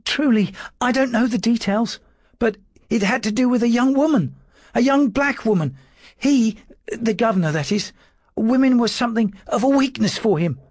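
high, thin timid clerk's voice; a frightened servant trying to sound firm; nervous little secretary. A narrator's reading. Dawes answering his lordship's questions, high and nervous.

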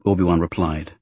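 usual speaking voice

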